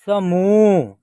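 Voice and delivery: समूह loud, with a long held ू; drawn-out model reading. Shown as a demonstration. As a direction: stretch the end of समूह. Teacher models the blended word.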